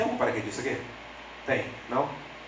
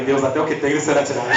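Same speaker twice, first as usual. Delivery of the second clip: laughing mock lament; 5 dB louder than usual. Mock lament quoting scripture.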